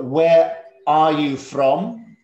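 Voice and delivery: slow and deliberate, each word spaced out; slow model question. Shown as a demonstration. Teacher showing the class a question, slow and clear. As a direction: model the question slowly for the pupils. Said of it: "There are two ways to ask this question".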